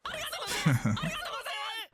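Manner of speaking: soft unvoiced embarrassed laugh; an airy laugh at her own gushing; breathy laugh. Real, not acted. Caught rambling to viewers, a soft embarrassed laugh.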